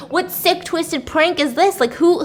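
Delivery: ordinary talk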